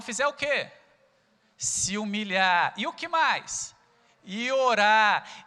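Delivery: loud, slow, emphatic preaching of the verse; fervent preaching. Acted. Pastor exhorting the church with the verse, loud and emphatic. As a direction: drive each word home to the congregation. Preacher building to full force.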